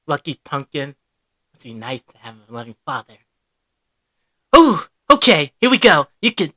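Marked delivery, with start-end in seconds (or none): 0.1-3.2 low, soft, fast bitter mutter; bitter quiet aside. Acted. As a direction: grumble it under your breath, hurt. Bitter aside about father.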